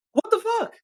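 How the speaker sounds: high, loud outraged disbelief; a real high-pitched what-the-heck burst. Real, not acted. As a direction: blurt it out in pure disbelief. Outraged disbelief at military plane cost.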